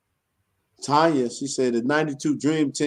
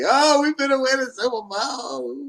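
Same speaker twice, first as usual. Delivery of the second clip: boastful fan; 11 semitones higher than usual. Retold or imitated. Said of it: "boy, everybody".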